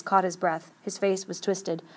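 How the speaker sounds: ordinary delivery, nothing marked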